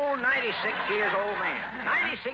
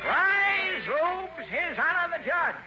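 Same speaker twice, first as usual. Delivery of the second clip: court bailiff call; 10 semitones higher, much slower than usual. Acted. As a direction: announce the judge grandly. Bailiff announcing the judge.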